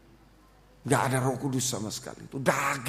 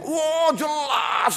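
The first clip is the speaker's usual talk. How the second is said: high, drawn-out shouted retort; re-enacted yell; 13 semitones higher, 4 dB louder, much slower than usual. Preacher re-enacts answering God's question, high drawn-out yell. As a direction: yell it back, stretching the words. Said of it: "saya teriak-teriak".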